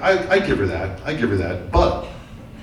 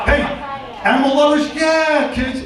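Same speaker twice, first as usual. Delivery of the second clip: calling out; 11 semitones higher than usual. Real, not acted. Calling out to the crowd.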